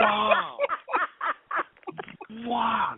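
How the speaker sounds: stunned wow. Real, not acted. Stunned after prank reveal.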